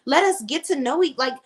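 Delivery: imitating an upset wife, high-pitched; upset pleading. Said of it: "now she's all upset".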